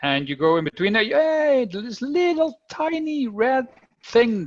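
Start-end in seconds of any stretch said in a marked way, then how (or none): none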